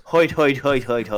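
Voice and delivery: mock Irish villain panting hoit; leprechaun. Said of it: "he goes".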